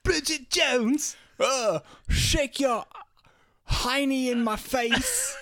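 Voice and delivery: high, loud, drawn-out fake English; hammed-up, bad British celebrity impression; Hugh Grant. Mocking an English film star, slow high put-on accent. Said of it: "that doesn't sound like Hugh Grant".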